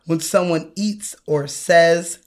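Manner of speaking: usual speaking voice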